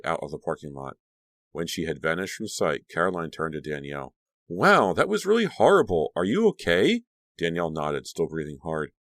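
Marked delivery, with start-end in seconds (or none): 4.5-7.0 high, lively concern. Acted. Caroline shaken, asking Danielle if she's okay, high. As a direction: check on her friend anxiously.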